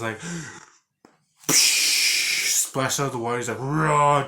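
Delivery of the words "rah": mock monster roar; a short hammed-up Nemesis roar. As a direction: growl it like a hulking monster. Retelling Nemesis roaring.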